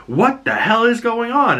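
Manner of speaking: high, startled outburst; mock panic at the raid; alarmed confusion. Matt hears the FBI raid below, high startled. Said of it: "he's like".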